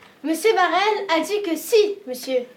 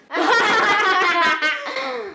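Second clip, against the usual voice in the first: high savage burst of laughter; wild laugh; 5 semitones higher than usual. Acted. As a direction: cackle wildly together. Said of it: "d'un rire sauvage".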